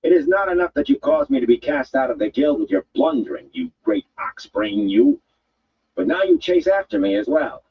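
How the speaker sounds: cast-out guild woman rounding on a thug; angry woman. A narrator's reading. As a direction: spit contempt at the big oaf. Woman Aludra voiced.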